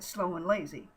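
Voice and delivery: ordinary delivery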